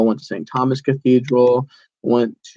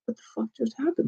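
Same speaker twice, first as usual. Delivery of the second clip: high, hushed and rattled; a shocked hunter hisses as shots ring out nearby; hushed alarm; 11 semitones higher, 9 dB quieter than usual. Retold or imitated. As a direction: mutter it scared under your breath. Freaked out by surprise gunshots.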